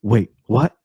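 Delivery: mock puzzled "Wait, what?" rising. Parodying bad script dialogue, mock puzzled. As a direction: sneer at the cliché line. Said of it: "somebody goes".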